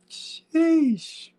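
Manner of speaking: a long squeaky falsetto "Sheesh" that falls away. Real, not acted. A hyped player reacting with a falsetto sheesh. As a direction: stretch "Sheesh" way up high, then let it drop. Hype exclamation in voice chat.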